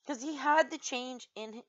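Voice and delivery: usual speaking voice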